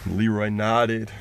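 usual speaking voice

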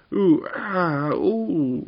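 high, loud wailed oohs and ah; pained yelling. A narrator's reading. Tuppeny dragged out by the legs, yelling. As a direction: yelp out drawn cries like a hurt goblin. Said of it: "yelled Tuppeny".

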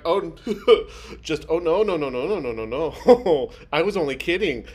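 laughing no no no; panicked laughing retreat; laughing backpedal. Retold or imitated. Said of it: "they're like".

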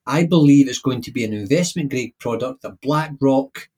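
ordinary talk, nothing marked

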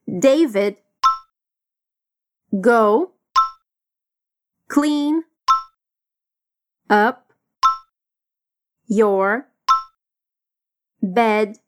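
each word alone with long pauses; slow word-by-word drill, pausing after each. Shown as a demonstration. Teacher drilling a student word by word, slow and separated. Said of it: "Vamos repetir".

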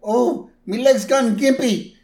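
high, loud, hammed-up Irish lament; Irish accent. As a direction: wail it as a poor Irish workman. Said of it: "I can't do an Irish accent".

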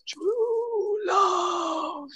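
high, soft, drawn-out wheeze of words; Wesley. Retold or imitated. Storyteller as mostly dead man, chest pressed, faint high moan. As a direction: let it leak out thin and long like squeezed air. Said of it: "he presses on his chest, and Wesley goes".

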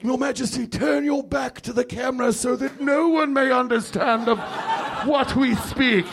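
high, hushed-then-pompous British courtier; mock British courtier. Courtier to his king, mock British aside. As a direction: advise it like a secretive royal aide. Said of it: "characters that are like".

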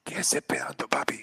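soft breathy-free whisper, teasing; a mock sultry whisper; sexy whisper. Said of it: "Con esta voz. Sexy".

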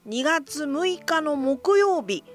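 normal talking voice

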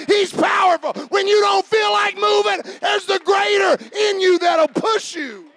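high, loud, driving sermon; full-force preaching. Real, not acted. Pastor urging the congregation to pursue, full-force preaching.

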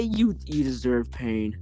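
normal talking voice

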